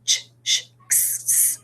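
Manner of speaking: "ch, sh, x, s" hissed with no voice; hissing sounds. Shown as a demonstration. Teacher hissing the letter sounds for the class. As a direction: hiss out "ch, sh, x, s". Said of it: "unless the word hisses".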